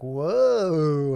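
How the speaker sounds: long whoa swooping up and back down; stretched, arcing whoa; playful whoa. Pretend. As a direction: voice the swoop of the movement. Voicing the rotation from below.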